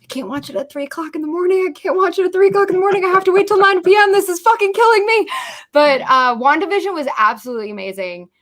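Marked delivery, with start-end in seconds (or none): 0.0-5.3 high, fast, rising to a near wail; mock desperation waiting for Loki; frantic fan panic. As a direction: build up to an anguished whine. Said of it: "So I'm sitting over here like".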